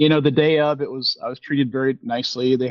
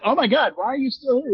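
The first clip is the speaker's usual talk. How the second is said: higher mock astonishment; retold surprise; 11 semitones higher than usual. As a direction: play your own startled self, pitched up. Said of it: "And I was like".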